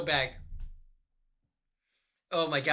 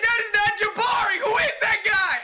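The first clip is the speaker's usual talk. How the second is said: high, loud mock panic; retold panic yell; 6 dB louder than usual. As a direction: shriek it like you just saw a stranger. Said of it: "I was like".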